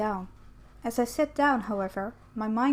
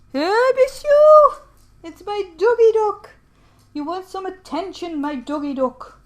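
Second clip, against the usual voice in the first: high doting voice for a dog; excited pet talk; 9 semitones higher, 12 dB louder than usual. Real, not acted. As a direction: gush at the pet, starting high and easing down.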